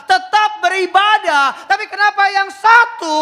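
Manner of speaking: high, loud sermon climax; preaching at full force. Acted. Preacher pressing the congregation, full-force preaching.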